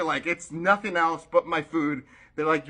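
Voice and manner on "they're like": normal talking voice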